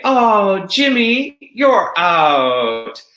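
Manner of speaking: high, loud playful announcement; playful call-out. Retold or imitated. Teacher calling out a student in the game, high and playful. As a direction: call him out with playful glee. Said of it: "And I would say".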